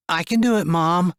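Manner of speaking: put-on high young boy's voice; keen to help; boy volunteering to his mom; eager boy. A narrator's reading.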